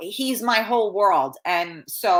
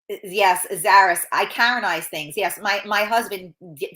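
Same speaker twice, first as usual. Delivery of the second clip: ordinary talk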